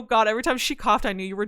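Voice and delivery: ordinary talk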